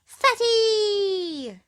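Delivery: high, loud teasing jeer; mocking taunt. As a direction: sneer it like a bratty girl. Miss Trouble taunts Mister Uppity.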